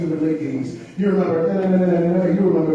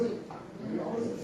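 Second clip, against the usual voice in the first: whispered echo; 6 semitones higher, 14 dB quieter, slower than usual. Retold or imitated. Whispered repeat.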